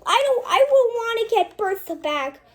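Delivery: loud, high hurt outburst; loud high betrayed lament; heartbroken outburst. Acted. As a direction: cry out your betrayal. Character pouring out heartbreak.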